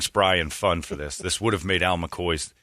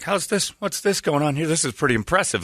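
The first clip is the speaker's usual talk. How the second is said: higher-pitched amazed buddy; mock amazement; 8 semitones higher than usual. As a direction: retell a buddy's impressed questions, pitched up. Acting out buddy's amazed question.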